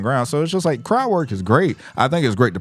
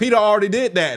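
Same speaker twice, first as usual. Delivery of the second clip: high mock crowd voice; mock crowd heckle; crowd retort; 12 semitones higher than usual. Crowd telling headliner.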